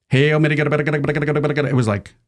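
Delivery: slow garbled mock chanting; mock gibberish prayer. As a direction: mimic a prayer you can't understand, slowly. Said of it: "unintelligible gibberish".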